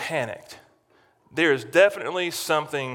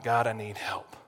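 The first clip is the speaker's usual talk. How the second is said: pleading prayer; 4 semitones lower, 6 dB quieter than usual. Acted. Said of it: "he's looking to God".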